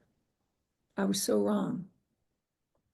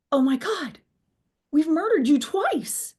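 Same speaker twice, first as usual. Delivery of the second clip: gasped, louder and higher retold shock; horrified exclamation; 6 semitones higher, 5 dB louder than usual. Telling how she reacted to watching the assassination, horrified exclamation. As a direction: cry it out aghast, drawing out the end.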